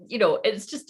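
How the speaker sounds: ordinary talk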